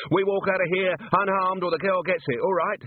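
high-pitched, snarling hostage-taker; tense, snarled hostage threat. A narrator's reading. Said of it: "he snarled".

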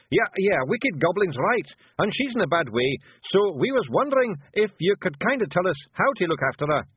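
high, rough little Scottish pictie, gently; a tiny Scots warrior breaking bad news; Feegle voice. Rob bluffs on.

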